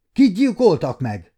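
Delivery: high, loud, dreading the answer; László demanding who was killed, high and loud; fearful question. A narrator's reading. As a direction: ask it fearing the worst. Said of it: "kérdezte rosszat sejtve".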